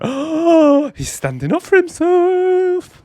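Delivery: gasp, then gush high and slow; mock amazed. The speaker reacts to Job fighting back: high, slow mock awe.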